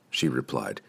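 ordinary delivery

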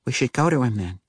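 decide it as the wife; woman's voice. Acted.

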